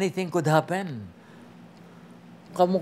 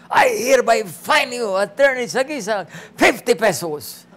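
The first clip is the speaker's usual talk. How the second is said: loud, raised-pitch mock ruling; imitated irate judge; 6 semitones higher, 5 dB louder than usual. Judge fining a lawyer for contempt, imitated outburst. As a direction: hand down the fine loudly, imitating the judge.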